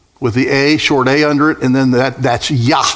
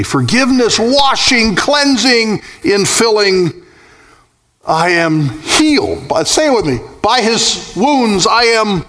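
high, sustained preacher's cry, words stretched out; soaring revival-sermon delivery; impassioned preaching; 9 semitones higher than usual. Acted. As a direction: proclaim it fervently at a high pitch, riding each phrase. Impassioned preaching at full force.

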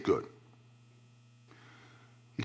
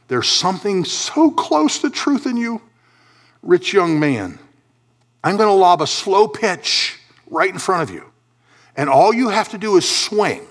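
raised pitch, emphatic coaxing delivery; Jesus; 7 semitones higher, 4 dB louder than usual. Acted. Jesus lobbing truth at the rich young man, high and emphatic. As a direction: spell it out to someone who nearly has it. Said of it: "Jesus said".

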